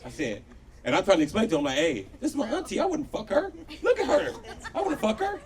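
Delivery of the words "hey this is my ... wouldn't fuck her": high-pitched pleading denial; squeaky, incredulous defence; indignant protest. Acted. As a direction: protest in a much higher voice. Acted protest to his offended uncle.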